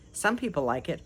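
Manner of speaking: ordinary delivery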